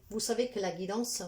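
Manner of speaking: ordinary talk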